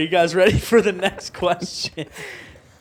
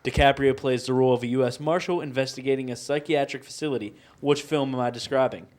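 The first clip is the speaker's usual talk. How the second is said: ordinary talk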